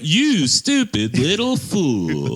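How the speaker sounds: hammed-up film line; imitating movie character. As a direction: draw out the insult in a high put-on voice. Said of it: "What does he say right before he does it?".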